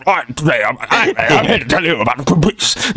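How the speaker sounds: blustering, garbled posh impression; Boris Johnson. Politician phoning about Greenpeace, hammed-up blustering posh voice. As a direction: mumble and bluster like a caricatured British politician. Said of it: "if it was Boris".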